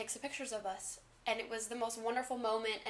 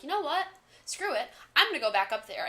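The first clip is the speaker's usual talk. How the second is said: a burst of defiant resolve, pitched up; sudden resolve; 5 semitones higher, 4 dB louder than usual. Retold or imitated. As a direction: snap into a "screw it" decision. Deciding to defy the bodyguard.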